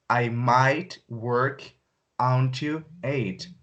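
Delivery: very slow, stressing "might"; slow, low repeat. Shown as a demonstration. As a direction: drag "might" out. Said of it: "repeat take".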